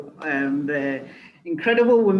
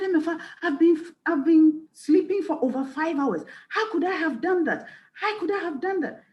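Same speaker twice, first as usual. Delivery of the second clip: higher pitched, hurried retelling of a panicked friend; frantic friend; 10 semitones higher than usual. Retold or imitated. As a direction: play the friend who can't forgive herself, high and frantic. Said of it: "she called me very frantic".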